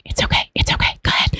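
whispered reassurance. Retold or imitated. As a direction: whisper it encouragingly. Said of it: "She's like".

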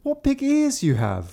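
put-on high, slow, wondering child; little girl voice. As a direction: gasp it out innocently. Kapturek przy łóżku.

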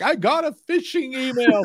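high, put-on voice of an alarmed emailer; mock panicky user. Mocking a user who overreacts to a scam. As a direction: play up the panic over a phishing email.

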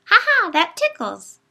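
high, loud giggly toy voice; a creepy teddy bear giggling out a canned line. A narrator's reading. Teddy bear giggles its line to the room, high and toy-like. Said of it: "giggled and said".

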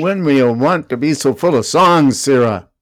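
Lear, a king scolding his fool; stage reading. Acted. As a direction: deliver it like a stern king. Reading Lear's lines.

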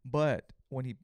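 ordinary talk

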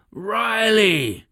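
bellowed name, high and loud; roaring tyrant king. Acted. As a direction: bellow across the forge. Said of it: "the king bellowed".

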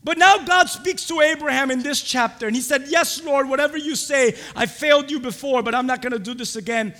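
loud, high, driving sermon delivery; full-force preaching. Real, not acted. Preacher to congregation on Abraham's failure, full-force preaching. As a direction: preach it out loud with punched words.

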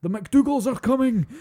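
high, loud mock shout, slow, in Scottish accent; imitated Scottish shout. Robert the Bruce shouting to Angus during ambush, loud mock Scottish yell. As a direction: yell across the water in a forced Scottish accent. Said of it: "Cause he goes".

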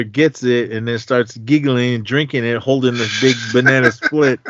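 ordinary talk, nothing marked

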